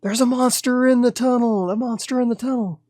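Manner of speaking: high, urgent yell of warning; panicked yell. Acted. Somebody fleeing the mine warns of a monster, panicked yell. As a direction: yell it out high, like raising the alarm. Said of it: "And somebody yells".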